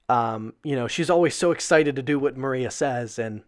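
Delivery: ordinary talk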